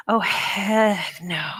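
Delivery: slow whispered aside. Real, not acted. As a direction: breathe it out, slow and hushed.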